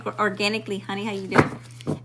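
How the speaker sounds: ordinary delivery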